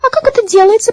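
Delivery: high squeaky little voices; squeaking mice. A narrator's reading. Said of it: "пропищали мыши".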